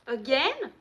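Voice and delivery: surprised question. Retold or imitated. Friend on the phone, surprised by another oil change, asks "Again?" sharply. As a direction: throw "Again?" back in mild disbelief. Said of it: "She's like".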